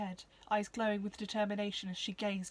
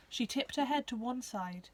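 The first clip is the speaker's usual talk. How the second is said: plain reading voice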